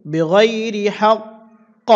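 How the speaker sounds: বিগয়রি হাক্ক drawn out slowly with a big echoing qalqala on the final ক; slow teacher's model of বিগয়রি হাক্ক; slow demo pronunciation. Shown as a demonstration. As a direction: stretch বিগয়রি হাক্ক slowly and bounce the ক hard at the stop. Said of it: "উচ্চারণটা হবে এভাবে".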